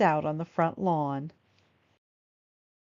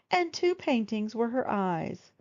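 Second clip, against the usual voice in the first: plain reading voice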